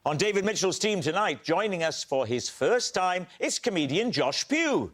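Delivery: upbeat TV host introduction; show host intro. Acted. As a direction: announce the guests with energy.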